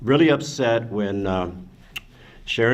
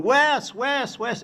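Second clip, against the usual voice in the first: much higher, urgent calls to a friend; calling out; 13 semitones higher, much slower than usual. Retold or imitated. Calling to Wes to bring the getaway van, urgent high shouts. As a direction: cry out for Wes to come with the van. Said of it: "and I'm going".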